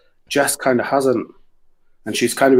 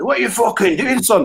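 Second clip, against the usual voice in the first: put-on Glasgow accent; 10 semitones higher than usual. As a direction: yell it as an angry Glasgow bouncer. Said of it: "with a Glasgow accent".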